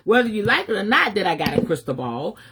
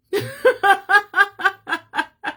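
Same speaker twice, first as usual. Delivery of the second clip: real derisive laugh; high laugh; 10 semitones higher, 3 dB louder than usual. Real, not acted. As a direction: burst of high mocking laughter. Laughs at people's idle time.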